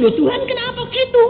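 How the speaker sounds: high, quick "Loh Tuhan" in disbelief; retold surprised protest to God; startled protest. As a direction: ask God why, taken aback.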